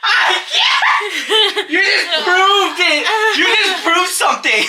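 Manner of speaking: high, loud burst of laughter; laughing. Real, not acted. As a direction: laugh the words out helplessly. Brother cracks up at sister.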